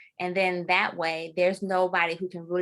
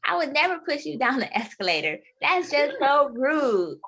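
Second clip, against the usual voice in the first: hammed-up offended innocence; mock innocence; 8 semitones higher, 4 dB louder than usual. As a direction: play wide-eyed innocent. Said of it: "I was like".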